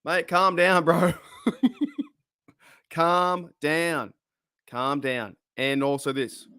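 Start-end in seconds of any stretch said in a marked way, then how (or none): 0.0-5.3 laughing calm down. Real, not acted. Laughing at viewer's fireworks.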